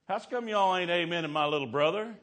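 loud, high imitation of a protective brother; mock outrage, loud and high; indignant brother's challenge. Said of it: "He's thinking".

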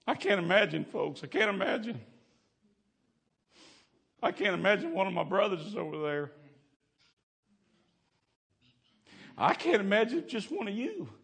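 high, loud pleading cry; preacher breaking into a high anguished plea; anguished preaching. Real, not acted. Preacher overcome with emotion.

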